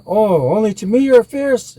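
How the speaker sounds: high, loud taunting call; defiant Coyote. A narrator's reading. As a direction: throw the challenge at the bear, high and loud.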